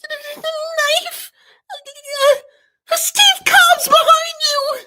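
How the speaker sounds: very high panicked shout; Paper's voice. Acted. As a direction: yelp the warning as Paper. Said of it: "in Paper's voice".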